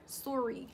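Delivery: sorry with the "sore" vowel, falling. Shown as a demonstration. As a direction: try the "sore-ee" sorry again. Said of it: "They be saying sore".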